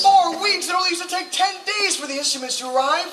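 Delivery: startled. Acted. Partner balks at the longer scheme.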